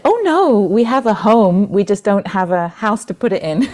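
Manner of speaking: put-on high, bright-eyed little girl's protest, easing down; lightly voiced child, starting high and loud. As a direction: correct them like a child, pitch leaping on "Oh no". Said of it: "the little girl said".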